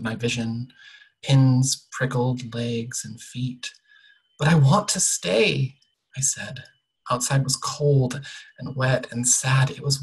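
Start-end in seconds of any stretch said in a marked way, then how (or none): 4.4-5.8 put-on higher, louder childlike plea; pleading protest. A narrator's reading. Girl begging the dream voice to let her stay, pleading.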